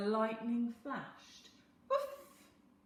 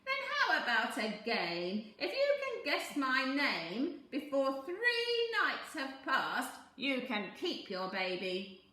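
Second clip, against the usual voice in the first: sly little man; 4 semitones higher, 7 dB louder than usual. A narrator's reading. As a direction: bargain like a crafty imp.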